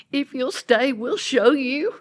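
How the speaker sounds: gently imitated child offer; little girl. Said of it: "one of the little girls said".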